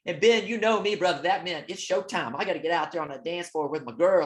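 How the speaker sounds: normal talking voice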